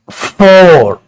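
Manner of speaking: फोर drawn out with a long open o; teacher modelling the o sound in फोर; sounding out four. Shown as a demonstration. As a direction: stretch the vowel in फोर clearly. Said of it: "यहां ओ की sound है".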